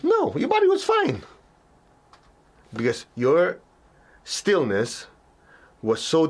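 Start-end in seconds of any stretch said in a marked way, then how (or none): 0.0-1.2 much higher, emphatic no; high correcting no. Real, not acted. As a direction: cut the worry off brightly. Teacher answers the imagined gasp.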